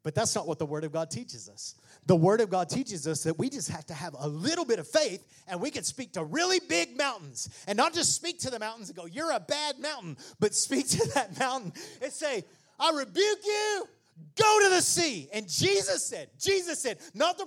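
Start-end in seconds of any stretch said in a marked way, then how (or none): 2.1-12.4 rising from firm to shouted preaching; preaching at full force. Acted. Pastor urging the church, climbing into full shout. 12.8-15.3 high, loud commanding rebuke; shouted rebuke. Shown as a demonstration. Preacher shows how to speak to the mountain, shouting.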